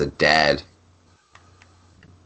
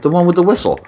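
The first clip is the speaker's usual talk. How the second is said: much higher, lightly voiced small child; little kid voice; 11 semitones higher than usual. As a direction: answer like an earnest little boy. Dad voicing his young son.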